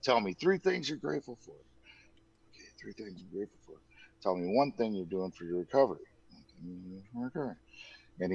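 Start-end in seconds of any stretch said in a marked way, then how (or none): 1.5-3.8 half-whispered echo while noting it down; much softer murmur to self; muttered repeat. Retold or imitated. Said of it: "And then he's like". 6.3-7.6 quick, much softer echo while scribbling; hurried note-taking murmur; fast muttered repeat. Retold or imitated. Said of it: "he's like".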